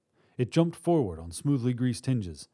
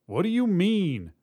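high, raised-voice question; shouted; 9 semitones higher, 4 dB louder than usual. A narrator's reading. Robber at teller window to partner, shouted question. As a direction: call back demanding an explanation. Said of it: "the man at the teller window shouted".

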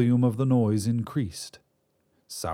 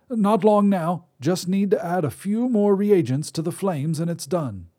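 put-on higher reedy old man's voice; reedy old wizard; 8 semitones higher, 3 dB louder than usual. Wizard voice held.

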